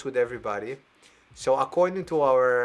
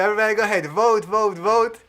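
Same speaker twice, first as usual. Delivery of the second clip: rallying call; 6 semitones higher, 7 dB louder than usual. Real, not acted. Urging audience to vote.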